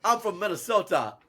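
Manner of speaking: badly faked British-Minnesota accent; botched Minnesota accent. Shown as a demonstration. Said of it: "trying to do a Minnesota accent".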